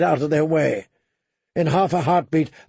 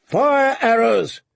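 high, drawn-out battle order; commander's raised, stretched-out cry; shouting; 8 semitones higher, 4 dB louder, slower than usual. A narrator's reading. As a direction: shout it slowly along the wall. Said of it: "he shouted".

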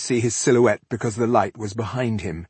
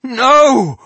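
high, loud cry of protest; shouted; 12 dB louder than usual. A narrator's reading. Rebel cutting off his comrade, panicked shout. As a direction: cry out to stop her. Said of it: "shouted Cedric".

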